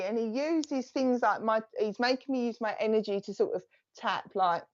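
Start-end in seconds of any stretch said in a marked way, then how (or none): none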